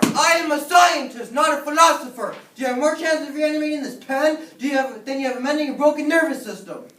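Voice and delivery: loud, high, indignant tirade; irate professor. Acted. Frankenstein defends his science to student, irate and loud. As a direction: shout the student down with authority.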